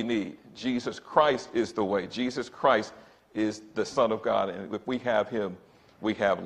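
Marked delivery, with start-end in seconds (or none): none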